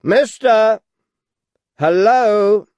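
loud call after someone. A narrator's reading. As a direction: call him back loudly. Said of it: "called out".

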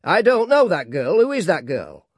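Gradgrind's dictatorial voice. A narrator's reading. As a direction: demand it bluntly. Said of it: "inflexible, dry, and dictatorial".

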